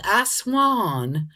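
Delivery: bad attempt at a Southern accent. Shown as a demonstration. Demonstrating how they heard the phrase, in a poor Southern accent. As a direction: put on a clumsy Southern twang. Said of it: "that's a horrible Southern accent".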